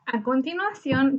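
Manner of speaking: ordinary delivery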